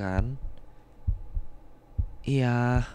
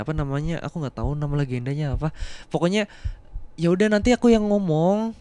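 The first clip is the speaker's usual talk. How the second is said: ordinary talk, nothing marked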